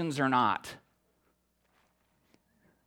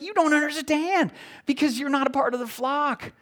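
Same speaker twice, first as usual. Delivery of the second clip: higher, emphatic exasperated reply; animated Jesus quote; 11 semitones higher than usual. Retold or imitated. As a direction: press the point home to doubters.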